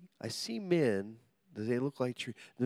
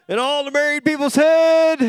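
much higher and louder call for a response; loud prompting call; 14 semitones higher, 15 dB louder than usual. Shown as a demonstration. Preacher repeats the line, loud and high, to coax married couples. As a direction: shout it out to get an amen. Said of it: "they didn't say a word".

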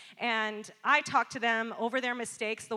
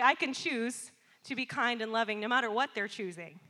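ordinary talk, nothing marked

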